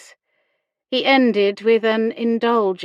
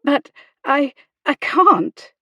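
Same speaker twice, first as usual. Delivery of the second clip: high-pitched, flustered halting protest; sputtering; 7 semitones higher, 6 dB louder than usual. A narrator's reading. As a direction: stumble over the refusal in alarm. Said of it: "Hannah sputtered".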